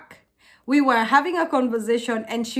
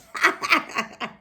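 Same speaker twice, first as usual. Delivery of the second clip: breathy, voiceless burst of laughter; real chuckling fit; amused laugh. Real, not acted. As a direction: laugh at your own dramatics. Laughs after her emphatic demonstration.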